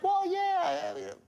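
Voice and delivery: lightly acted high-pitched agreement; disarmed prospect. Prospect gives in to the salesman, high and disarmed. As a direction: concede it in a high voice.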